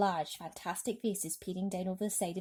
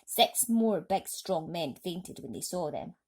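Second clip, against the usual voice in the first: ordinary delivery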